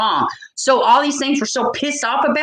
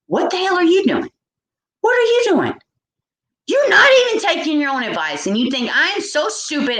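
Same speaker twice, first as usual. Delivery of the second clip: angry outburst; 7 semitones higher than usual. Real, not acted. Vlogger yelling at the hypocritical rich, angry and high. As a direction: call them out for hypocrisy.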